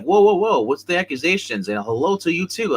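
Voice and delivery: high mock-taken-aback voice; retold startled protest. Dwight answering the agent's accusation, retold startled protest. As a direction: jump up in pitch, fending off an accusation.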